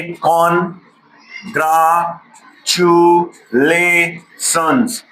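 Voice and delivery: Congratulations drawn out very slowly; teacher models congratulations slowly for the class; slow spelling demo. Shown as a demonstration. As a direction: stretch congratulations piece by piece. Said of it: "ऐसे करके हम पढ़ाते हैं".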